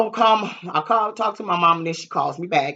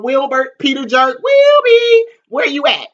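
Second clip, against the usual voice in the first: calling out; 9 semitones higher, 10 dB louder, slower than usual. Retold or imitated. Calling through the house for him.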